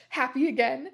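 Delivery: ordinary delivery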